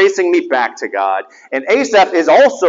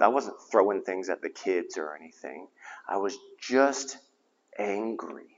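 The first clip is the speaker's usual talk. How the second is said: much softer, low and hushed; barely above a breath, much softer; hushed confession; 9 semitones lower, 15 dB quieter than usual. Real, not acted. Confides his anger to the congregation, hushed and much softer.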